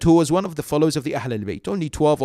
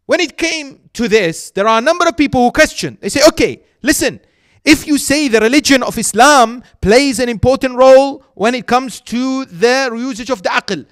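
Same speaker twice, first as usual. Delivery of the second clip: raised, pitched-up sermon delivery; preaching at full force; 9 semitones higher, 9 dB louder than usual. Acted. Preacher voices the young questioners, loud and emphatic. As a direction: press the youths' question on the hall.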